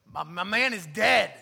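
loud, incredulous insistence; punched-up loud objection; emphatic protest. Acted. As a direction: insist on it loudly, as a doubting sister. Voicing disbelieving sisters at tomb.

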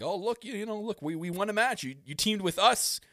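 high, cocky heel promo voice; Kenny Omega impression. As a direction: ham up a smug wrestler boast. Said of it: "Kenny Omega be like".

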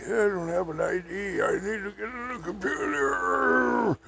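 a high, panicky drifter pleading at the library; agitated Okie. Retold or imitated. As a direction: squeak out the Okie's panic. Screaming Okie voiced, high.

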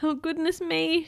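mildly prim, polite little exclamation; polite storybook girl. Acted. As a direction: say it politely, slightly lifted. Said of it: "she says politely".

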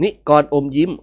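usual speaking voice

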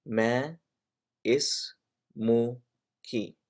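very slow, a pause between syllables. Shown as a demonstration. Teacher showing learners how to ask a woman, slow and syllable by syllable. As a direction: break it up for a learner to repeat.

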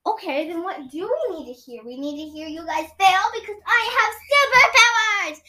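high, loud gloating villain voice; evil alien villain. Acted. Doctor X-Org gloating at the children, loudly.

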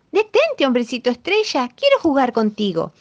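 sharp high shout on detente; calling out. A narrator's reading. Dog calls after the star man, high shout. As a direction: yell after him to stop. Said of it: "le gritó".

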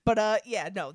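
ordinary talk, nothing marked

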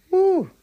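sudden high joyful woo. Real, not acted. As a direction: let out a happy church woo. Jubilant outburst after testimony.

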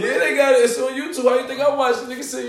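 ordinary talk

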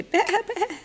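high bleating sheep. Pretend. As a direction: bleat it like a lamb. Parent voices the sheep.

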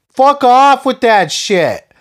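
high, slow, drawn-out angry yell; furious high shout, slowed down for emphasis; angry outburst. Real, not acted. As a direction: throw it back at the critics with disgust. Angry dismissal of critics.

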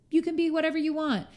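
high, airy hands-off permission; mock indifference. Speaker imitating a partner who doesn't care, breezily indifferent. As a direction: wave them off in a high voice. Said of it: "doesn't care about you".

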